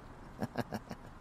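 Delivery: hushed flirtatious chuckle; soft chuckle. Acted. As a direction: chuckle softly under the breath. Flirting after a playful threat.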